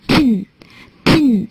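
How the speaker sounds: slow "tin" with a weighty, ringing ending; heavy final "tin". Shown as a demonstration. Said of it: "十五的发音的尾音比较的重".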